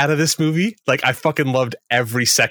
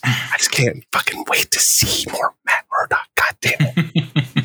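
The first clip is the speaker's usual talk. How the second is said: slow, straining unvoiced rasp of excitement; overcome with eagerness; 3 semitones higher, slower than usual. Real, not acted. Fan desperate to see more Matt Murdock, straining with excitement. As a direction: squeeze it out in a slow, strained whisper.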